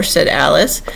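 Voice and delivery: usual speaking voice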